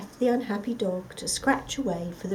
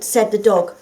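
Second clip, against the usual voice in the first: plain reading voice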